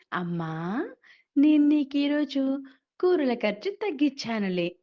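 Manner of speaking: కొడుకు గర్వంగా గొప్పలు చెప్పడం; boastful. Acted. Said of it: "గొప్పగా చెప్తాడు".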